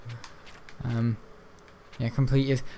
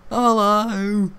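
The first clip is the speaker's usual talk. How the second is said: put-on London kid accent; 6 semitones higher than usual. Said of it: "like those London kids".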